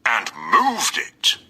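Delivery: slow, deliberate papa bear voice; Father Bear. A narrator's reading. Father Bear finding his chair moved, slow papa voice. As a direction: spell out each word like a big bear. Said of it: "Then Father Bear noticed something else".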